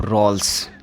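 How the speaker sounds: रोल्स ending in a hissed s; wrong s ending. Shown as a demonstration. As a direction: close रोल्स on a plain unvoiced s. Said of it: "ये नहीं बोलेंगे आप".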